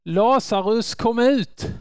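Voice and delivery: commanding call to the dead man. Acted. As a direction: call him out of the grave, ringing. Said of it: "Han ropar".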